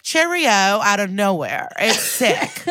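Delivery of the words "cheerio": high, sing-out British farewell; hammed-up, high British accent imitation; mock British accent. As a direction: chirp it as a put-on Brit. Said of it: "we'll just be like".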